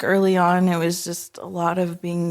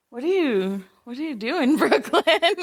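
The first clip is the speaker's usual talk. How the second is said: higher, laughing puzzled question; retold bewilderment breaking into laughter; amused mock questioning; 7 semitones higher than usual. Parents to their child bopping in the car seat, amused puzzlement.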